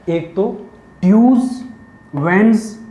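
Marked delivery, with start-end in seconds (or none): 1.0-1.5 ट्यूज said loud and high with a z; teacher stressing ट्यूज; emphatic. Shown as a demonstration. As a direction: punch ट्यूज. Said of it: "इन तीनों में ज".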